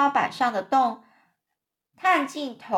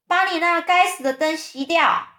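raised, higher-pitched shout; angry yell; 7 semitones higher, 4 dB louder than usual. A narrator's reading. Centipede yells at the firefly to put out the light, shouting. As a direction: yell it up at the ceiling, irritated. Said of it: "他就大叫一声".

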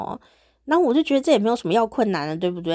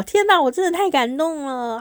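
higher, gushing and moved; deeply touched; 7 semitones higher than usual. Real, not acted. Host thanks bold fans, higher and gushing. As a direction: gush with delight at the fans' gifts.

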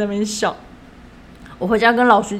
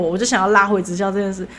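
normal talking voice

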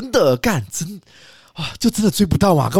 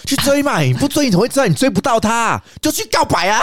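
higher, egging-on friend voice; playing the nagging friend, pitched up; pushy friend; 8 semitones higher than usual. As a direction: urge him to confess, teasing and up high. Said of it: "旁边的朋友一直跟你讲说".

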